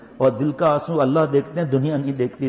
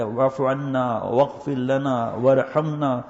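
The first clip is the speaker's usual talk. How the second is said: slow prayer recitation; much slower than usual. Acted. Recites supplication slowly.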